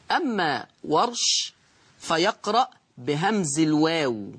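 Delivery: ordinary talk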